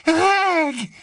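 high, loud cry of a name in relief; joyful cry. A narrator's reading. Wife spotting her husband, crying his name out. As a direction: call out to him with sudden joy. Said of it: "s'écria Mrs Cattermole".

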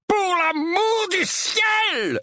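high, very drawn-out bellow; outraged roar. A narrator's reading. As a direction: roar it out, stretching every word. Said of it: "rugit l'oncle Vernon". (rugissement outré et traînant)

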